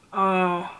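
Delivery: slow, drawn-out reluctant question; mock disappointed stalling; not interested. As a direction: drag it out, imitating an unimpressed date. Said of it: "he's just not interested".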